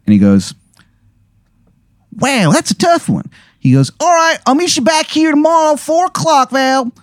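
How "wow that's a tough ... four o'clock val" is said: put-on much higher, fast Baltimore accent; bad Maryland accent. Informant says goodbye to the player, Maryland accent. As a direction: sign off breezily in a Maryland accent. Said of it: "the worst Maryland accent".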